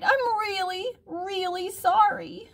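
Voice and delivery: put-on very high child's plea; crying out in apology. A narrator's reading. Harriet begging her mother's pardon, high childlike cry. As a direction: cry it out high like a small girl. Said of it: "Harriet cried".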